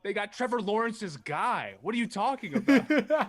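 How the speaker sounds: high, loud, incredulous rebuttal; excited protest. Real, not acted. Co-host defending the pick, high and incredulous. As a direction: argue back loudly in disbelief. Arguing back about the shock pick.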